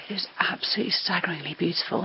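hushed, fast whisper. Real, not acted. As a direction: breathe it out in awe. Hushed awe in ancient shrine.

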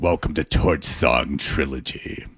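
slow, low gravelly announcer; Harvey Fierstein. Retold or imitated. Welcoming the crowd to Torch Song Trilogy, gravelly. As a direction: open on a whisper, then deep gravel. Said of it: "The Harvey Fierstein".

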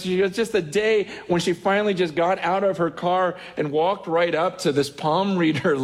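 ordinary talk

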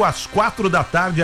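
ordinary talk, nothing marked

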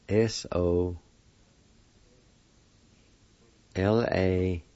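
letter by letter, long pauses; spelled out. Shown as a demonstration. Teacher spelling pendulum for learners, spelled out slowly. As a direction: spell it slowly in small groups. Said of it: "it's spelled like this".

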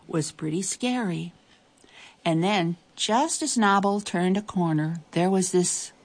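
plain reading voice

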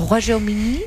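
tentative attempt at a French accent on "Royaume"; French pronunciation. Shown as a demonstration. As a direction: say "Royaume" as an unsure Spaniard trying French. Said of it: "la pronunciación en francés no, no sé muy, muy bien". ("Royaume" con acento francés, a modo de intento)

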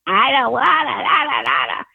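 high screechy comic rasp; retelling the duck audition, screeching imitation; Gilbert Gottfried screech. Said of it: "with that".

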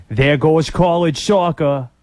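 put-on slow, thick Boston accent quoting the movie line. Quoting The Town in a slow Boston accent. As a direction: drop into a heavy Boston accent, slowly. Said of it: "That's a good Boston".

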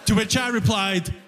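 usual speaking voice

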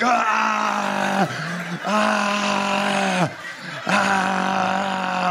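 long, high held "ah" cries; aggressive kissing noise. Retold or imitated. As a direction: imitate an aggressive open-mouthed French kiss. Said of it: "start aggressively Frenching me, just like".

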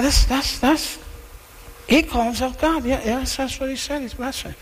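a scribe nervously repeating the scandal; scared scribe. Retold or imitated. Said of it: "them saying to one another".

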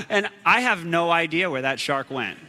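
usual speaking voice

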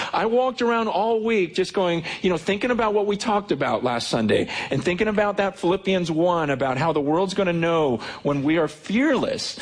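high, eager preacher voice; lively, high-pitched testimony; fired-up preaching. Acted. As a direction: preach your own courage with zeal. Excited preaching about fearlessness.

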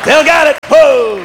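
high, loud boast; triumphant crowing. Real, not acted. Comic crowing that he's still got it, triumphant. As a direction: whoop it to the crowd.

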